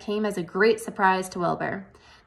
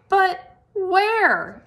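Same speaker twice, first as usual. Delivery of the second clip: high, louder anxious question; Wilbur asking; 11 semitones higher, 5 dB louder than usual. A narrator's reading. Wilbur asks the spider where, high and anxious. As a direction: ask in worried alarm. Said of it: "asked Wilbur".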